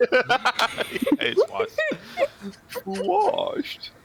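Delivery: squeaky laughing mockery; high giggling jab. Real, not acted. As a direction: crack up while teasing. Players mock a character, laughing.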